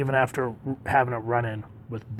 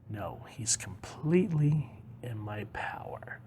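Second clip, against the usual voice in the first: hushed villain; 11 dB quieter, slower than usual. Acted. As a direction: savour it slowly, almost whispered. Villain claims control of Superman.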